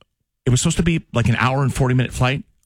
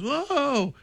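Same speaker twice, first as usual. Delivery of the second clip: whoa; 7 dB quieter than usual. Retold or imitated. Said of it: "the whole plane goes".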